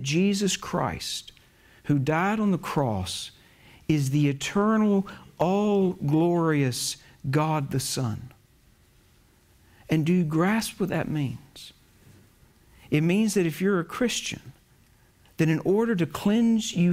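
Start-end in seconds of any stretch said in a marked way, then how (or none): none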